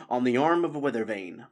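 usual speaking voice

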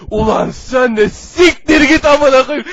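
loud, slow, furious shout. Acted. Hero turns on his calm teammate, furious shout. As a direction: bellow it out in fury. Furious quarrel between heroes.

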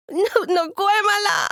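put-on high, slow, shouted boy's no; a stubborn kid yelling no; shouted refusal. Boy yells his refusal at the man offering ice cream, high and stubborn.

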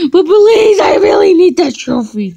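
high, loud desperate plea; shouted begging. Acted. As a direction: beg at full volume.

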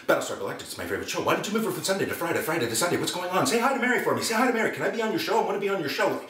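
rapid-fire excited rush, climbing high; Robin Williams, machine-gun patter. Retold or imitated. Excited star accosting an actor on the sidewalk, machine-gun patter. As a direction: fire off questions breathlessly, pitch rising as it goes. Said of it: "He spoke in machine gun".

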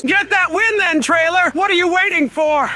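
high-pitched impatient goading; goading taunt. Acted. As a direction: egg the rival on, pitched high. Taunting a rival player.

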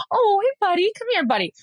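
high sweet pet-talk, squeaky on hey. Retold or imitated. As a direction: coo at a dog to come over. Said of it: "when you talk to animals".

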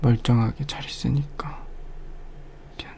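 very soft reassuring whisper. Acted. Whispering to a scared partner, tender. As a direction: breathe the comfort out.

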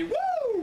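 high, shrieking wrestler's woo; a strutting champion's woo; Ric Flair woo. Acted. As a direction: let out the signature Flair woo. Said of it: "Ric Flair would break into my house".

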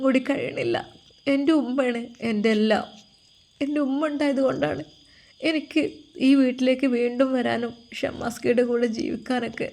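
a lightly voiced daughter, pleading and tearful.

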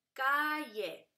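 "Calle" as ca, lle, keeping ll whole; "Calle" drawn out by syllable; split into syllables. Shown as a demonstration. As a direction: stretch "Calle" in two beats, first one loud. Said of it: "no se divide".

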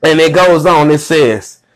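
normal talking voice

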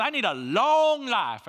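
hammed-up farmer pleading for a "long" life; drawled long. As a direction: wail "long" as "lo-ong". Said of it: "I didn't know long had two syllables".

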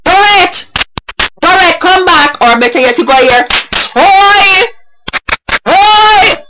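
high, loud hollered call. Acted. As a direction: holler someone back from a distance. Calling someone back while clapping.

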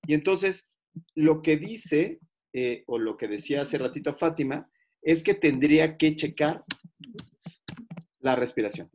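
ordinary delivery

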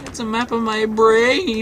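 high silly voice. Pretend.

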